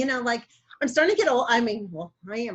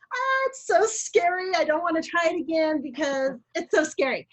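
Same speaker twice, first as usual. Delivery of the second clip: mock scared; 5 semitones higher than usual. Client retelling her dread of retrying the diet, mock scared. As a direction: plead off in a high, acted-out fright.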